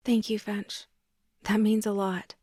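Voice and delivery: ordinary delivery, nothing marked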